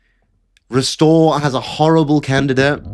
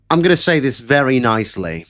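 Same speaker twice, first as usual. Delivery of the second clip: ordinary delivery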